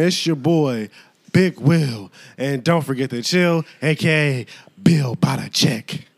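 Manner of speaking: low, cool host intro; podcast intro. Acted. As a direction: introduce yourself like a radio host.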